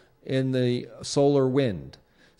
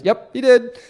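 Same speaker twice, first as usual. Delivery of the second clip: higher and louder, a put-on voice; amused confirmation; 10 semitones higher, 6 dB louder than usual. Man replaying the squirrel video, confirming in a louder, higher comic voice. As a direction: pop it out in a funny character voice. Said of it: "He goes".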